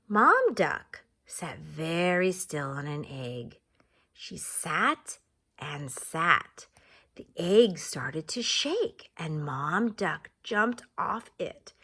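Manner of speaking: slow, low read-aloud voice; teacher reading a picture book to her class; storybook narration. Acted. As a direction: read it slowly like a bedtime story.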